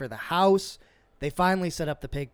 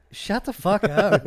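louder, slower, higher disbelief; retold shocked friend at a bar; incredulous outburst; 5 semitones higher, 6 dB louder, slower than usual. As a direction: shut it down in disbelief. Disbelieving friend's pig claim.

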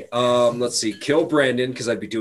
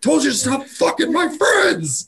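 angry mom; slower than usual. Acted. Acted furious parent yelling.

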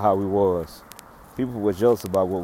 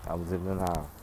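quieter, lower, heavy admission; soft confession; 5 semitones lower, 12 dB quieter than usual. Real, not acted. Quietly admitting his denial to Tremaine. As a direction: let it sink, almost to yourself. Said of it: "confessing again, softer".

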